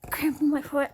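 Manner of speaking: whispered through the pain. Real, not acted. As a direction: hush it out while hurting. Foot cramp mid-recording.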